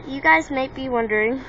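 ordinary talk, nothing marked

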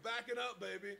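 ordinary talk, nothing marked

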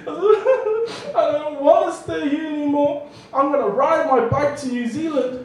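put-on boy crying as he rides off; mock crying. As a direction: whine it through fake tears. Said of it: "and I was crying".

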